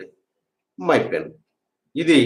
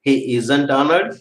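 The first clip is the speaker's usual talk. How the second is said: very slow dictation; much slower than usual. Shown as a demonstration. Teacher dictating to students, very slowly. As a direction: stretch every word of "He isn't honored." wide apart. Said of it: "teacher dictating sentence".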